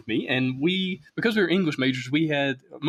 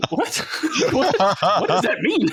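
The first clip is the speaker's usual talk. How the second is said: high, louder incredulous "What?" through laughter; laughing disbelief; 8 semitones higher, 3 dB louder, slower than usual. Real, not acted. Reacting to a neighbor's absurd truck answer, laughing incredulously. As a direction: crack up at the nonsense answer.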